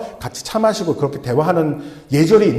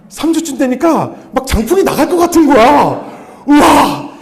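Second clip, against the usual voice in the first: higher and louder, laughing at himself; excited boast; 10 semitones higher, 5 dB louder than usual. Retold or imitated. Preacher laughing at his own pride in healing power, loud and high. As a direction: puff up like he could shoot energy blasts.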